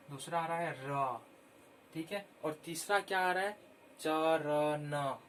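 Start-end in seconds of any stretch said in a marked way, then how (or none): 4.0-5.2 चरण drawn out very slowly and louder; very slow pronunciation. Shown as a demonstration. Teacher stretching चरण so children hear each sound. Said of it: "बोलने से ही पता चल".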